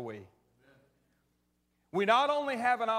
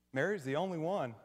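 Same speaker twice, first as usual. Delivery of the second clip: ordinary delivery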